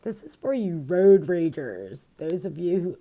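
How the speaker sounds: loud, swooping announcement; mock call-out. Imitating a friend pitching a stone to road ragers, loud call-out. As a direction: call it out to a whole group, imitating a seller.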